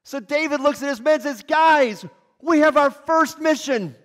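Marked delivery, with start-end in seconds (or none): 1.4-4.0 loud, high rally to the men; rallying call. Acted. David announcing the mission to his men, loud and high.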